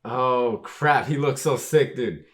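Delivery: loud, high fan hype; thrilled, real outburst; excited. Real, not acted. Hyped reaction to the character's look.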